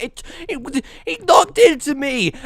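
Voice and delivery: indignant retold protest, rising high; stammer, then blurt your defense loudly; acted-out flustered protest. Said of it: "And I was like".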